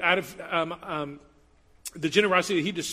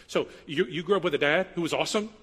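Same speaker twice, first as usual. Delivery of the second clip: normal talking voice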